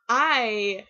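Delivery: telling viewers about her friend's visit, excited. Real, not acted. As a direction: push it out with excitement. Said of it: "very excited".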